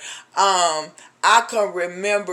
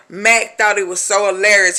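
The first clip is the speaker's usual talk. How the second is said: ordinary delivery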